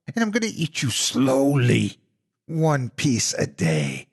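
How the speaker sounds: slow, gloating monster threat; growling monster. Acted. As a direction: draw out the last words with relish. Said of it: "she growled".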